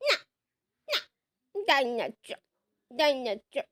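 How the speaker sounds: put-on low, whimpering little boy; toddler whining for dinosaur. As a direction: sulk and whine for the toy. Toddler wants his dinosaur. (জজ ডাইনোসরের জন্য ঘ্যানঘ্যান করছে, নিচু গলায়)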